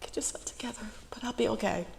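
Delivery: high, soft, choked self-pep-talk; tearful. Real, not acted. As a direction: pull yourself together through real tears. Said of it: "I'll wipe away my little tears".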